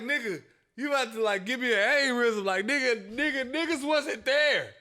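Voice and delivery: loud, high, exasperated impression; mock exasperated yell. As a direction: blurt it out like him. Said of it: "He be like".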